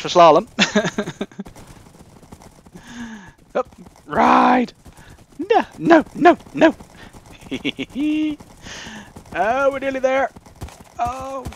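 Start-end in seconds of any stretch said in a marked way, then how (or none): none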